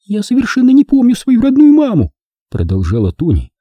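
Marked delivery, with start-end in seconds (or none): 0.0-2.1 put-on high, childlike musing; musing to herself. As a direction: think aloud like a lost child. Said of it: "как бы рассуждая сама с собой".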